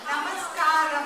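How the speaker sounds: Namaskaram said loud and clear, NAH-mus-kah-rum. Shown as a demonstration. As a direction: call out Namaskaram loud as a model. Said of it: "just told you what to say".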